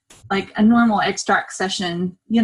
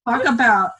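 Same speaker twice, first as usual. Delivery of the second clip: usual speaking voice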